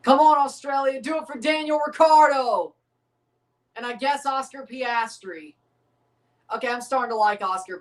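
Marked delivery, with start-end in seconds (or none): 0.0-2.7 loud, high cheer for the team; rally the Aussies on, full voice. Real, not acted. Cheering on Australia.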